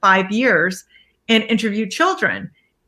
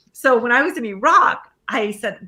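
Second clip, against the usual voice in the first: ordinary talk, nothing marked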